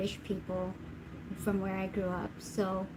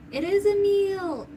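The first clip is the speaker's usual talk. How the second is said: arguing side; 9 semitones higher, 4 dB louder than usual. Pretend. Mock debate, two sides.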